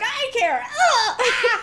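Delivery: high, loud wail with laughter; hammed-up yelps of objection; mock squeamish protest. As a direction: protest comically, pitch shooting up. Retold protest at being touched.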